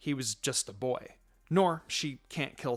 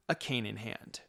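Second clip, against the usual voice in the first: ordinary delivery, nothing marked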